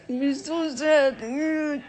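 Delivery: slow, high, breaking voice; tearful plea. Retold or imitated. Will telling his father he still loves him. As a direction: let the words crack with tears. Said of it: "And Will said".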